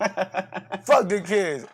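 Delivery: slow, drawn-out half-yell; milder defiant repeat. Pretend. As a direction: stretch it out, holding back the shout. Repeats the retort less forcefully.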